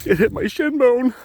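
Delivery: Peter Griffin hurt. Retold or imitated. Imitating Peter Griffin whining over his shin bone. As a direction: whimper it like a cartoon dad. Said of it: "like Peter Griffin when he falls".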